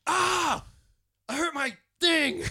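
high, loud comic yelp; imitated scream. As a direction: scream it out like a panicked film character. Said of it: "he just screams, and he's like".

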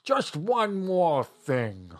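high, very drawn-out detective impression; Columbo. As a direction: stretch it out like a TV detective's catchphrase. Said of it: "who is Columbo's pooch".

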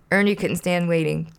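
plain reading voice

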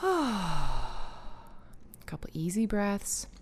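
very slow, soft calm cue; guided breathing. Acted. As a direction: ease them into the breath.

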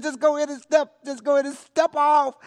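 high, loud goading; retold urging. Relative urging the player to step off the plank, loud retold goading. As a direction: urge them on, loud and high.

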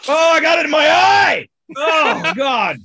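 loud high yelp of pain; pained shout. Real, not acted. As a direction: cry out, stung in the eye. Chip dust in eye.

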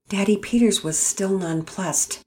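usual speaking voice

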